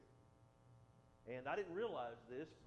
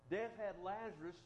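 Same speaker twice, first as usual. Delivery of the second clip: ordinary talk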